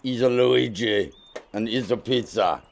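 comic put-on Italian accent; fake Italian accent. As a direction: play the cheesy Italian pizza man. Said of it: "They just forced me to do the accent".

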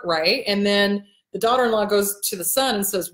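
normal talking voice

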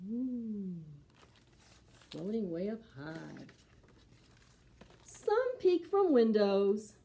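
slow, expressive picture book reading; storybook voice for preschoolers; reading to children. Acted. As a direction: read it slow and lively for little ones.

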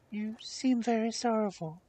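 high, concerned princess voice; kind princess. A narrator's reading. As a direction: play a kindly princess, pitched high. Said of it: "she said in the kindest way".

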